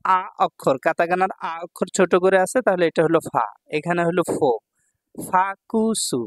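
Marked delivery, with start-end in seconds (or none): 5.2-6.2 ফাকুসু stretched out, very slow; slow model word. Shown as a demonstration. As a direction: spell ফাকুসু out slowly by sound. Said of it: "teacher models word slowly".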